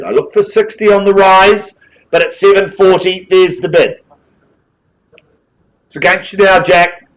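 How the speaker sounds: brisk auction patter, rising on calls; auctioneer calling bids. Real, not acted. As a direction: drive the room like a live auctioneer.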